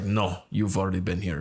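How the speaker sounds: imitated Russian refusal, heavy accent; thick Russian accent. Hairdresser refusing client a free cut, thick Russian accent. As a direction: turn him down in a Russian accent. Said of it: "very thick Russian accent".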